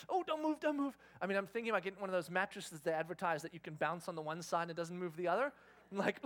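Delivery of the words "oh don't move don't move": mock panic. Panicking as bed bounces near baby.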